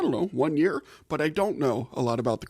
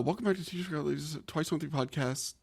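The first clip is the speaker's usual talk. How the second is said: quieter retake; 8 dB quieter than usual. Shown as a demonstration. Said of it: "Let me try that one again".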